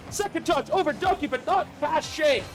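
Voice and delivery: fast, high, energetic commentary; live play-by-play. Real, not acted. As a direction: call the match live.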